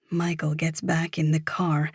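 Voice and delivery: usual speaking voice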